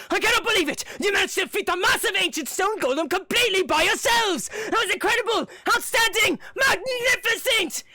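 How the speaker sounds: happy praise. Acted. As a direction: heap on the praise, thrilled. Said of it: "Sombra announces happily".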